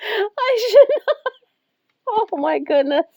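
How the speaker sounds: high, breaking words through real laughter; trying and failing to stop laughing; laughing so much. Real, not acted. Owner scolding herself over laughing at the cat, laughing hard. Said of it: "I should not be laughing so much".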